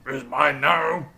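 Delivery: slow, loud possessive ogre. Acted. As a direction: claim it like a stubborn giant. GM voices ogre dialogue.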